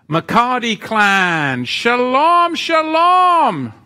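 high, loud sung-out shalom; called-out greeting. Acted. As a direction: call it across the room joyfully. Calling out greeting to chat.

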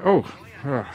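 usual speaking voice

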